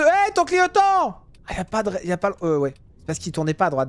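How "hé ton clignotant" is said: high, loud outraged yell; indignant shout. Real, not acted. Driver yelling at a car without its indicator, indignant. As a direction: call him out for no indicator.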